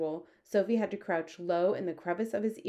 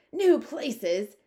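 loud, high echo in disbelief; high, indignant shout; outraged shout; 6 semitones higher, 7 dB louder than usual. A narrator's reading. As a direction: shout it back, appalled. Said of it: "shouted the head of the Air Force".

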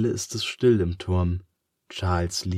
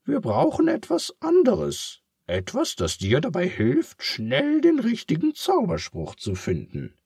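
comforting pig; 12 semitones higher than usual. Acted. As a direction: cheer up your friend in a high pig voice. Charles voiced.

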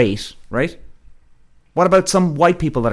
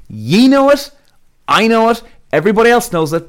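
emphatic shout; 8 semitones higher, 5 dB louder than usual. Real, not acted. Ranting emphatic claim.

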